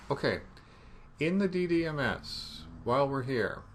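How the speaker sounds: ordinary delivery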